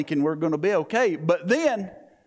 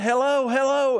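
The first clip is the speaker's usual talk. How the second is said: high, louder call into the radio; 7 semitones higher, 3 dB louder than usual. Retold or imitated. Trying to reach someone on the plane radio, urgent call. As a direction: hail anyone listening, urgent.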